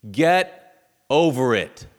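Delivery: loud, slow, hard-hitting command; blunt dismissal. Retold or imitated. Pastor to the person dealing with it, blunt dismissal. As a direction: land each word heavily and slowly. Pastor's harsh message to camera.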